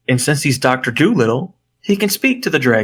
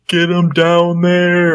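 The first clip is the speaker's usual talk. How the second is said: long-drawn, higher-pitched call; mock urging call; 6 semitones higher, 3 dB louder, much slower than usual. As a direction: stretch every word out like calling from afar. Acts out characters urging on gorilla.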